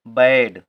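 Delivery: बैड said with an open ae vowel, firmly and loudly; correct reading. Shown as a demonstration. Teacher models बैड for Hindi learners, loud and clear. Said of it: "बैड पढ़ेंगे".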